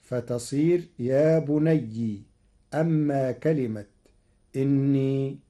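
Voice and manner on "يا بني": يا بني ending in a kasra ي, ya bunayyi; slow, close بني on a kasra, bunayyi; yaa with kasra. Shown as a demonstration. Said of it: "بكسر الياء". (كسر الياء في يا بني)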